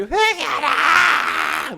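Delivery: high, drawn-out mocking call to repent; mock preacher's cry. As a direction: stretch it out like a ranting preacher. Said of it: "뭐 이런 설교를 들으니까".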